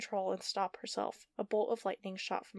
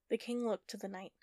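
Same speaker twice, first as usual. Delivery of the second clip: ordinary delivery, nothing marked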